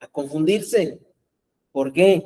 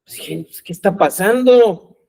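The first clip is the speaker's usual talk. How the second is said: acted bewilderment; 8 dB louder than usual. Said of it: "Entonces sus discípulos".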